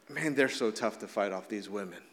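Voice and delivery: low, soft mock admiration; understated mock awe; sarcastic. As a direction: drop into a dry sarcastic aside. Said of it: "It's sarcastic".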